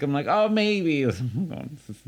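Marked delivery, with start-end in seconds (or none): 0.3-1.0 high, mock-polite hedging; noncommittal reply. Answering an invitation to come back, noncommittal.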